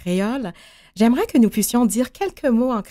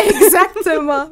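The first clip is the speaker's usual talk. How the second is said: a high, loud laugh around the word; laughing agreement; 10 semitones higher, 5 dB louder than usual. Real, not acted. Host laughing at the guest's joke about sharing her accent, high and loud.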